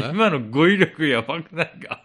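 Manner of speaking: loud laughing protest. Real, not acted. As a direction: burst out laughing while talking. Laughing at his own word. (笑いながら)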